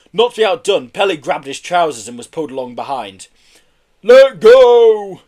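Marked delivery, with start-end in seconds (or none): 4.0-5.2 very loud, high shout. A narrator's reading. Marbo to Herb and Pelly clinging on, shouting. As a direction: shout down at the hangers-on. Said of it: "shouted Marbo".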